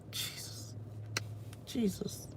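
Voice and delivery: ordinary talk, nothing marked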